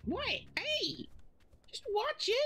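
very high indignant little goose; squeaky goose protesting. Pretend. Goose voice, caught.

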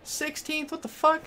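high, stunned cry of disbelief; shocked outburst. Real, not acted. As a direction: yelp it out in real shock. Shocked at losing pit positions.

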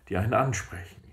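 ordinary talk

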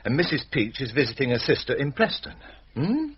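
usual speaking voice

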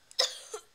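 loud, hammy fake cough fit. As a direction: cough it up theatrically. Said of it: "Let's pretend coughs".

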